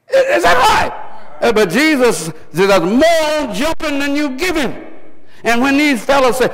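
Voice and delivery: high, emphatic preacher's cry; full-force preaching. Real, not acted. Preacher warning the congregation about the devil's tricks, impassioned.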